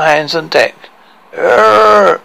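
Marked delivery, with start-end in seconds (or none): 1.3-2.2 loud, rough pirate growl; gruff captain's grunt. A narrator's reading. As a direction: bellow like a grisly sea captain.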